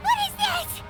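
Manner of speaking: gasp, then high shocked question; high-pitched, appalled disbelief. Acted. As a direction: recoil in horror at what you see. First sight of the bad portrait.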